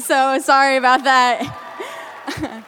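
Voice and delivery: slow, loud call-out apology to the crowd; half-laughing shouted sorry; loud sheepish apology. Real, not acted. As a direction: say sorry loudly and slowly across the room.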